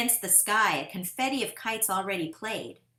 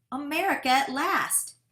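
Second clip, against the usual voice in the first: joyful cry; 7 semitones higher, 4 dB louder than usual. A narrator's reading. Kite line reaches America.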